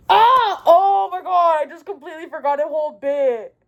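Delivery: oh no, I skipped a whole part; sudden realisation. Real, not acted. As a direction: blurt it out as it hits you. Suddenly remembers a forgotten bit.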